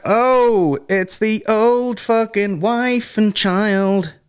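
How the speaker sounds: high, drawn-out exclamation; mock surprise. Spotting the wife and child outside, high mock surprise. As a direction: call it out high, stretching the last words. Hails family seen outside, exaggerated.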